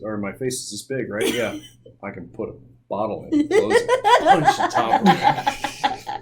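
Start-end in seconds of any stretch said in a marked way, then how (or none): 2.9-6.2 laughing while talking; cracking up mid-sentence. Pretend. Joking about his huge dragon mouth.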